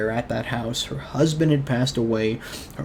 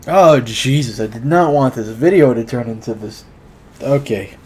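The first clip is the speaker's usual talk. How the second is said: choked up; 6 dB louder than usual. Real, not acted. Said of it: "I cried like a baby then".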